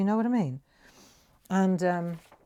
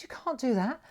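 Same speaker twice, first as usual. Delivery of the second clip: indignant retold protest; 7 semitones higher than usual. Retells confronting foreman over the stick.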